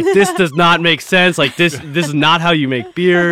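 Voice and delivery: loud, high mock German brewer accent; hammed-up German brewer scolding. German brewers telling the founders this is not how to make beer, in a loud mock German accent. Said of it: "I can't do a good German accent".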